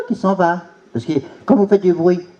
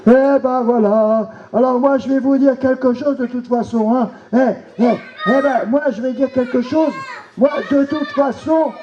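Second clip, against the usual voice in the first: a nasty puppet villain crowing; gloating villain; 5 semitones higher than usual. Acted. Villain plotting potion.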